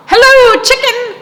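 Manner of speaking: very high, loud duck hello; Duck's greeting. A narrator's reading. Storyteller voicing Duck greeting Chicken, very high and loud. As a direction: squeak it out brightly as Duck.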